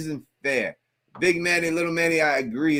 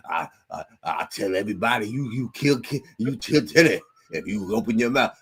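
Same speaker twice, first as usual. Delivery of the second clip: low mock-threatening uncle, rising out of it; uncle impression; 4 semitones lower than usual. Man doing Boyd's threat as his uncle talked, mock menace. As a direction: lightly play an old-time uncle issuing a threat. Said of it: "my uncle back in the day would talk like that".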